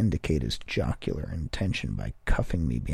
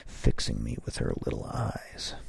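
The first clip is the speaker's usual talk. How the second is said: plain reading voice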